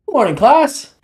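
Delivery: high, bright nervous greeting; nervously excited teacher. Acted. Teacher greeting his class, nervously excited. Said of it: "a hint of a nervous excitement in his voice".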